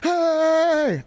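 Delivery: high, loud mock yell; mock yell at ref. Kid yelling at the referee, a high, loud mock shout. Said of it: "he starts yelling at the ref".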